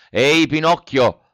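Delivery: a loud shout of Pinocchio's name; shouted call. A narrator's reading. The biggest boy shouting at Pinocchio, a loud call. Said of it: "Gridò allora il più grande".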